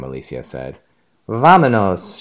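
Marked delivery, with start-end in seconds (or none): 1.3-2.2 shout. A narrator's reading. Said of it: "shouted Dora".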